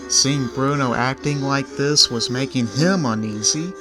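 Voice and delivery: usual speaking voice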